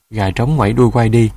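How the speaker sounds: ordinary delivery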